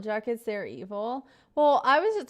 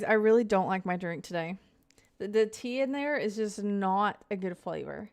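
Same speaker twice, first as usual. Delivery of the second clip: ordinary talk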